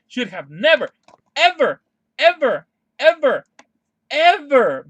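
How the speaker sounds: each "ever" punched loud and high, falling; emphatic outrage. Real, not acted. Fan condemning the Watchmen crossover, hammering each "ever". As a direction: hammer every "ever" as a separate outburst. Ranting against a comics crossover.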